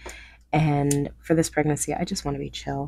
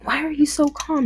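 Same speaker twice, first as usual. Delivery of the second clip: much higher exasperated question; mock freak-out; 11 semitones higher than usual. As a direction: act out a frantic demand to husband. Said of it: "Meanwhile, I'm freaking out".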